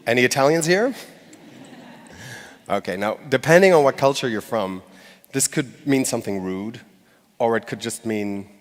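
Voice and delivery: ordinary talk, nothing marked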